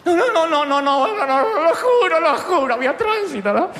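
loud, high, laughing frantic denials; panicked excuse. Retold or imitated. Dante pleading with his Italian boss, frantic. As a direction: beg forgiveness in a rush.

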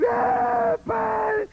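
high crying wail; hammed-up sobbing prayer; mock wailing prayer. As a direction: overwrought theatrical plea. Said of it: "¿Por qué le hablan así al Señor?".

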